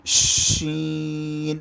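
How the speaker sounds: شین with a long full sh, ee held on one note; thick whistling شین. Shown as a demonstration. Teacher demonstrating the letter, شین thick and whistling. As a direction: hush thickly into a stretched شین. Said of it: "سی ٹی کی آواز کی طرح موٹی".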